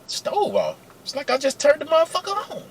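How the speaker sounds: high-pitched, disbelieving manager voice; incredulous manager. Retold or imitated. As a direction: squeak it out as a baffled boss. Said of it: "So he's like".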